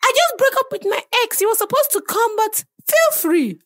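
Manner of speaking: high, loud shrieky cartoon voice; dopey cartoon bellow. Voicing woman in meet cute.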